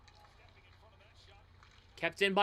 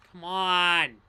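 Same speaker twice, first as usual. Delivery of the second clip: loud, high frustrated yells at the team; agitated yelling; 7 semitones higher, 10 dB louder, much slower than usual. Real, not acted. Fan yelling at his team stuck in their zone, angry yells. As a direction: bark orders at the screen in real frustration. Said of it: "Now I'm getting agitated".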